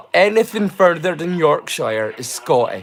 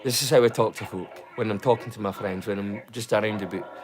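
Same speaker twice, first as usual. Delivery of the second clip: lower, softer broad Doric; 6 semitones lower, 6 dB quieter than usual. Shown as a demonstration. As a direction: talk like to friends in the northeast. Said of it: "This is me doing my normal voice".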